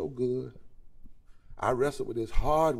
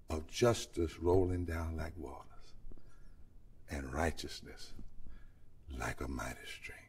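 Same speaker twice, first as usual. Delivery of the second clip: sinks softer and lower into a whisper; fading to a whisper; 8 semitones lower, 9 dB quieter than usual. Real, not acted. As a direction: let the verse die away to a breath. Fades scripture to whisper.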